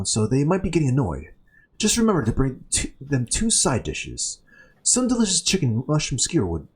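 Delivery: lightly acted, swooping storybook intonation; brief a new helper as a short-handed cook; busy worker character. Voices game character giving instructions.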